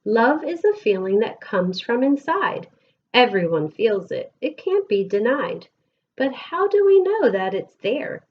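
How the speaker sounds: lilting, sing-song picture book reading; gentle storytime voice with bouncing rhythm; reading to children. Acted. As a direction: read the rhymes aloud to a class of kids. Reads picture book to class.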